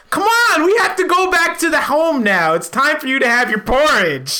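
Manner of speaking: high, singsong coaxing; hammed-up cheery carer; fake doting relative. Fake nephew coaxes uncle home.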